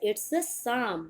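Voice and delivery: "psalm" as saam, silent p and l; slow It's a psalm with saam; correct pronunciation. Shown as a demonstration. Teacher repeating for students, slow saam. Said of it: "इसे हम बोलते हैं साम".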